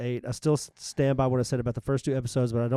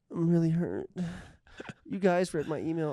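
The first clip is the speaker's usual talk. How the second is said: mock hurt; 4 semitones higher, 4 dB quieter than usual. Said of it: "Don't read it like that".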